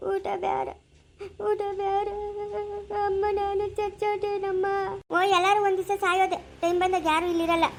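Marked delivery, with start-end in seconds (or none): 0.1-4.6 slow, faint and fearful; sick son asks his mother, slow and frightened; slow, weak plea. Acted. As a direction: ask it slowly, afraid. Sick son fears dying.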